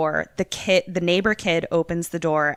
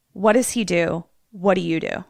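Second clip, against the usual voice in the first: ordinary talk, nothing marked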